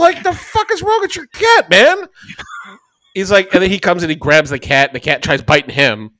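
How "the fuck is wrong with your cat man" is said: high incredulous outburst; outraged disbelief. Retold or imitated. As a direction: shriek it in shocked disbelief. Outraged quote at friend.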